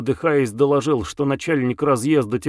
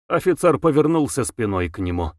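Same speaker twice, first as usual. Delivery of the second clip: ordinary delivery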